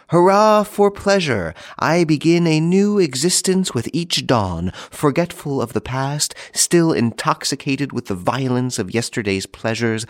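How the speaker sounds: high-pitched reveller's cheer, easing down; tipsy pleasure-seeker's hurrah, starting high; exultant cry. Acted. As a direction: cry out in drunken delight, then gradually settle.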